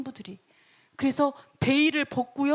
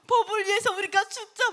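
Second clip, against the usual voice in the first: high-pitched, urgent appeal; bold rallying cry; 7 semitones higher than usual. Acted. Bold citizen urging others to die together, rallying. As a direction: call comrades to a brave stand. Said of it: "어떤 담대한 시민은".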